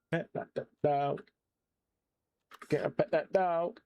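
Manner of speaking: goofy put-on voice rising to a high slow wail; silly building singsong. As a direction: climb up in pitch with every repeat, drawing it out. Silly chant building higher.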